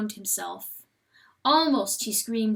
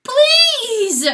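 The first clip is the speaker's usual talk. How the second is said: much higher, much louder begging cry; desperate plea; 14 dB louder than usual. A narrator's reading. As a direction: beg in anguish. Rooster begging the voice.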